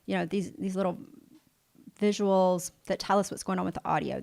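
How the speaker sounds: ordinary talk, nothing marked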